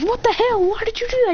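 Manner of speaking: high-pitched indignant questions; acted outrage. Rival confronting boy after accidental kiss, high outrage. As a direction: demand answers in a high mock fury.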